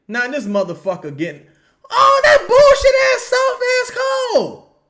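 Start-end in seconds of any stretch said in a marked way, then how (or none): 1.9-4.8 much higher, very loud outraged yell; furious shout. Real, not acted. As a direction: explode at the referee. Fan raging at ref call.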